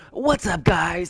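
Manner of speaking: hyped high "what's up guys" intro; loud, high, over-the-top YouTuber greeting. Shown as a demonstration. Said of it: "The YouTuber voice".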